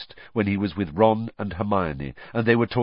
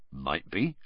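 softer, lower, noncommittal with a lift at the end; evasive; 4 semitones lower, 7 dB quieter than usual. A narrator's reading. Hagrid avoiding the question about Voldemort, evasively. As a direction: dodge the question quietly. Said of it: "he said evasively".

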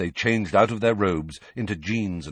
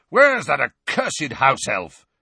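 slow, loud, annoyed demand; irritated call; 6 semitones higher, 7 dB louder, slower than usual. A narrator's reading. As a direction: call out for the missing elf, annoyed. Sirius calls for the house elf.

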